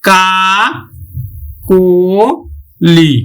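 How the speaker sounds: কা, কো, লি said apart, কো with an o sound; syllable by syllable. Shown as a demonstration. Teacher breaking the word for students, syllable by syllable. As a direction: spell out কা কো লি slowly, one syllable at a time. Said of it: "আমরা উচ্চারণ করব কিন্তু".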